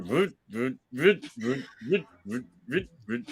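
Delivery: soft repeated "voot" ironing noises. Pretend. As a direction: mimic an iron gliding back and forth. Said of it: "You just go like".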